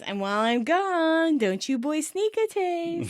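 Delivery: high, sing-song teasing. Retold or imitated. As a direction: playfully warn them, drawing out the last words. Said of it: "teasingly says".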